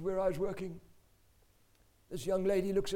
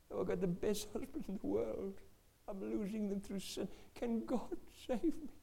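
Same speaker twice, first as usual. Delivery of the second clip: weeping confession; 4 dB quieter than usual. Retold or imitated. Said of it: "her weeping".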